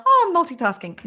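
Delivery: high, loud, showy boast; mock-proud multitasker. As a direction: exclaim it like a frazzled show-off.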